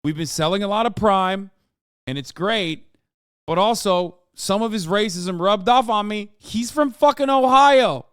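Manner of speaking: building to a loud, high punchline on Ohio; hammed-up blame on his pal, rising to a yell; mock excuse. Streamer pretends [name] blames [name], mock outrage. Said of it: "be like".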